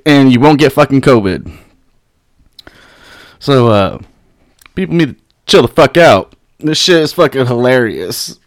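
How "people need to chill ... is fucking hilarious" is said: ordinary delivery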